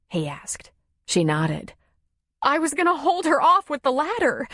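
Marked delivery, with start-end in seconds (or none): 2.4-4.5 high-pitched, upset explanation; shaken, distraught. A narrator's reading. Nora explains her plan against the bear, shaken. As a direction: insist on it in a high, rattled voice.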